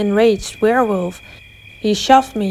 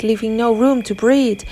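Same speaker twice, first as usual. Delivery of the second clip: ordinary delivery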